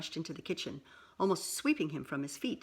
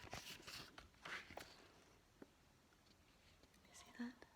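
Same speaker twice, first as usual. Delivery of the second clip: soft whispered question; 5 semitones higher, 23 dB quieter than usual. Real, not acted. Reader whispering to child, showing the picture. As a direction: barely breathe it.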